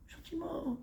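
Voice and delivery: high, very soft whispery word; gentle. Shown as a demonstration. Rabbi showing a husband how to ask, gently. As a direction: breathe it out softly and high. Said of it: "Mais comment tu le dis?".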